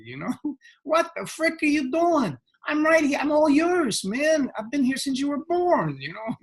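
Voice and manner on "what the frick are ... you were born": very high, exasperated mock holler; hammed-up high voice pleading to be noticed; happiness calling out. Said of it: "It's like forever calling out".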